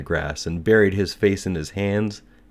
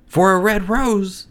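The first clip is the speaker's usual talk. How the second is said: very high, loud, drawn-out outcry; incredulous cry; 9 dB louder, slower than usual. A narrator's reading. Lizard, butterfly and daisy react to the nightingale, crying out high. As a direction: cry out high in mocking disbelief. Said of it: "they cried".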